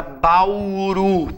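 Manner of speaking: BAURU drawn out slowly, long held final RU; stretched stressed syllable. Shown as a demonstration. Teacher asking which syllable is tonic, stretched word. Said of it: "qual é a sílaba tônica dessa palavra?".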